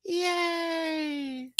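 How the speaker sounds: high, drawn-out little yay; mock cheer. Host celebrating end of a long review, high mock cheer. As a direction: squeal out a tiny stretched cheer.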